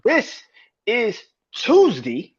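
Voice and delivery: hype intro. Acted. Hyping the weekly show opener.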